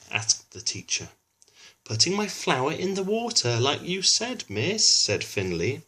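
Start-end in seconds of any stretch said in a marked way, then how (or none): 1.8-5.0 put-on high, innocent little boy; innocent child. A narrator's reading. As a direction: answer sweetly, sure he did right. Finley answers teacher innocently.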